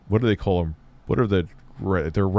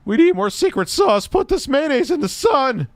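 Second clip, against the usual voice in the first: very high, whiny parody; mock the speaker in falsetto; squeaky mocking voice; 4 dB louder than usual. Mocking John's pitch.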